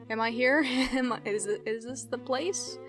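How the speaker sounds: normal talking voice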